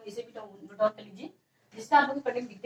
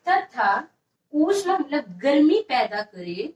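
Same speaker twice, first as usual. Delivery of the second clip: slow, deliberate phrases with long pauses; slow dictation; slower than usual. Shown as a demonstration. Teacher dictates definition once more to students, slow dictation. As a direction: dictate slowly, repeating for the writers. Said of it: "फिर से लिखिएगा".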